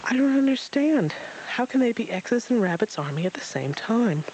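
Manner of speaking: put-on high, puzzled child voice; a confused little joey asking; little Roo. Roo's child voice.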